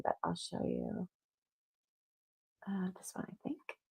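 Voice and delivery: hushed off-mic whisper while searching; whispered aside. Real, not acted. Host searching the screen, murmuring a whispered aside. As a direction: drop to a breathy whisper, half to yourself.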